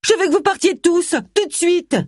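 ordre furieux, très aigu; emportement. A narrator's reading. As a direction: chasse tout le monde, voix très haut perchée. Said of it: "s'emporta Allison". (emportée, dans l'aigu)

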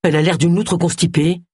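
mildly acid put-down; understated bitter remark; sour. Acted. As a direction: jibe with a touch of spite. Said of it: "s'exclama Agatha avec aigreur".

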